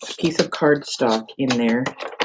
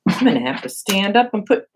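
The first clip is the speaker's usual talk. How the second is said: ordinary talk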